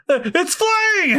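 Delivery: high, slow shocked cry; shocked shriek. Acted. Villain seeing the train fly at him, shocked. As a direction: gasp it out in disbelief. Spandam sees train coming.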